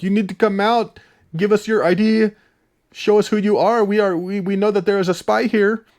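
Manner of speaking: high, loud barking Russian guard; mock Russian soldier demanding ID. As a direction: play the guard shouting into the showers. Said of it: "He's like".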